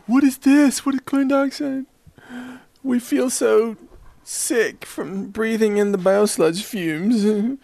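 high-pitched mock whining; put-on pitiful high whine, dragging out; bewildered sick arrivals. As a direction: complain in a silly high voice.

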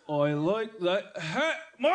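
slow Australian with lips and tongue held still; still-lipped Australian accent. Shown as a demonstration. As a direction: drag out mock Australian without moving lips. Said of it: "Don't move your lips or your tongue".

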